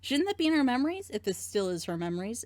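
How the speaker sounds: ordinary talk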